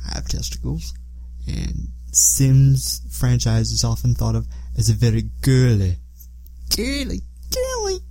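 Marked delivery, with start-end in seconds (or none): 0.1-1.9 quick hushed aside; whispering. Real, not acted. Joking aside about being a guy. 6.8-8.0 put-on girly voice. Mocking the girly reputation of Sims.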